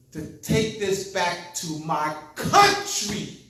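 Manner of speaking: loud, high, slow declaration of duty; proud vow. Retold or imitated. A Thai student vowing to serve his country, loud and proud. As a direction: proclaim it with fervent pride. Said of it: "He said".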